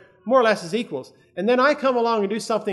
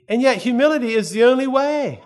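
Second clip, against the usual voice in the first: emphatic preaching; 5 semitones higher, 5 dB louder than usual. Real, not acted. Preacher drives the point home.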